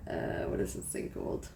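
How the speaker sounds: whispered question to herself; breathy whisper, puzzling. Real, not acted. Wondering aloud under breath.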